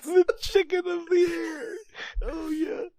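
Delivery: very high, squealed through laughter; helpless laughter. Real, not acted. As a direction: repeat the punchline in a strained, cracked-up squeal. Cracking up at the quote.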